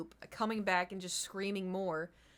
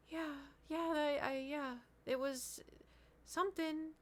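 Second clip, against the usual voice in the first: higher and much softer, trailing unconvinced; weak fake agreement; 7 semitones higher, 5 dB quieter than usual. Feeling ill, she feebly pretends the ride was fun. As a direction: force a feeble yes.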